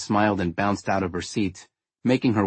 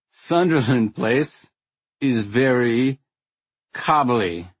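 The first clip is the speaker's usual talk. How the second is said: put-on drunk; 3 semitones higher, much slower than usual. Tipsy narrator speaking drunk.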